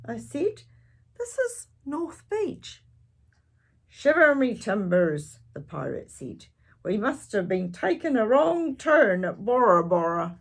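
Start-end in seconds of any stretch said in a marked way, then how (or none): 1.2-2.8 put-on child voice. Child answers pirate. 3.9-5.4 pirate voice. Acted. Pirate speaks. 6.8-10.4 pirate voice. Acted. Pirate speaks.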